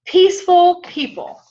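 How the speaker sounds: high, loud, drawn-out announcement of the point; emphatic proclamation. Real, not acted. Preacher to congregation, announcing the key, loud and high.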